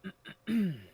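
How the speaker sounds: a loud, theatrical throat clear before the tale; storyteller clearing his throat before the tale. Acted. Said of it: "He clears his throat".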